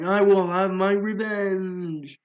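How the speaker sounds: high, loud, slow villain threat; seething shrieking spirit. Acted. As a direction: drag out the revenge vow, shrieking. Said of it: "And Asphira's like".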